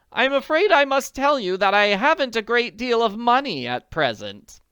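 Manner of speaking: worried wealthy woman; anxious society lady. Acted. Willoughby voice.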